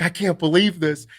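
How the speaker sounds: retold burst of amazed excitement; pumped-up fan. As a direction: gush it like a fan who's all pumped up. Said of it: "He was all pumped up".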